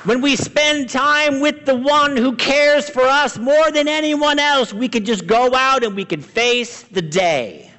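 high-pitched, fervent pulpit delivery; impassioned preaching. Real, not acted. Preacher urging the congregation to pray before the storm, impassioned.

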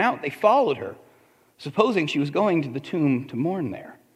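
calm, measured Bible reading; scripture reading. Acted. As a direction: read the passage steadily to the congregation. Reads scripture passage aloud.

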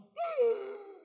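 high, loud sharp gasp for air; huge gasp. Retold or imitated. Preacher acting out his first breath, loud gasp. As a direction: suck in air like surfacing.

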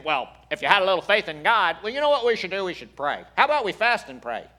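loud, high, pushy advice-giver; hammed-up preachy advice, loudly; mock correcting. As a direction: push your advice on them, high and loud. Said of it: "I'm not critiquing or correcting".

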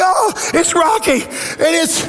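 high, crying half-shout; retelling himself sobbing high at the Rocky film ending; tearful outburst. Retold or imitated. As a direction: overcome with tears at the movie. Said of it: "I'm like".

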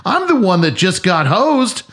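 husband retelling his reply to his crying wife; exasperated. Retold or imitated. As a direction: protest that you're the victim here. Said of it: "I'm like".

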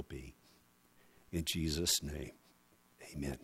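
soft, hushed prayer that fades toward a whisper. Real, not acted. Pastor praying with bowed heads, hushed prayer. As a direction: pray quietly over the people.

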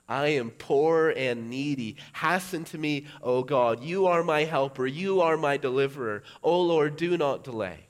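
pleading prayer. Retold or imitated. Psalmist crying out loud to God to rescue him. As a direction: call out to God, begging him to hurry. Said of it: "you can say with the psalmist".

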